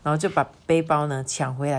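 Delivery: usual speaking voice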